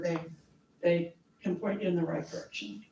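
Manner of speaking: normal talking voice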